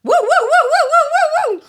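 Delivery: loud high yelping siren; siren wail. Shown as a demonstration. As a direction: yelp it out like a police siren. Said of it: "what's your best siren".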